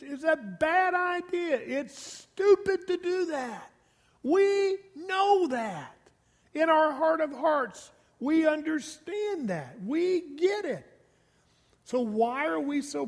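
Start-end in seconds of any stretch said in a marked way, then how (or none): 0.0-10.9 fervent pleading. Real, not acted. Preacher pleading about what we know.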